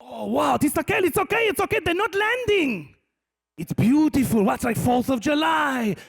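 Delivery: high, shouted mock-Israeli delight; excited Israeli guy. Israeli guy filming rockets as fireworks, wildly excited. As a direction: whoop over the spectacle like an Israeli onlooker. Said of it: "some Israeli guy going".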